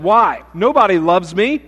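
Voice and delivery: a hint of a hopeless man on a bridge; despairing jumper. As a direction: jumper answering the rescuer. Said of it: "the gentleman who was about to jump said".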